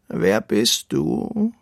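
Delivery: very slow, drawn-out deep creature voice. A narrator's reading. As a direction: ask it ponderously, stretching every word. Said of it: "hört er eine tiefe Stimme".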